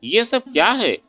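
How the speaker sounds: stunned brother asking what happened; shocked question. Acted. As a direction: ask it in disbelief.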